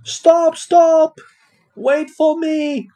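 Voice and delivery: high, loud storybook shout. Acted. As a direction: call after a runaway dog, high and loud. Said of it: "shouted Amber".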